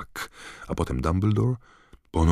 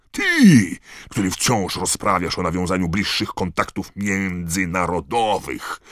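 much higher, louder raging rant; furious tirade; 7 semitones higher, 7 dB louder than usual. Acted. Karkarov raging at Dumbledore over the tournament, furious. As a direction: rant with rising fury.

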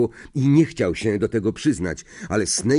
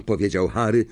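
ordinary delivery, nothing marked

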